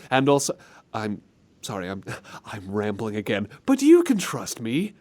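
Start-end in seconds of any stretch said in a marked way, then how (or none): 3.7-4.9 high-pitched, falsely friendly creep; wide-eyed, badly faked sincerity; untrustworthy smiler. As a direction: act the creepy over-eager friend. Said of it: "so what if I talk like this".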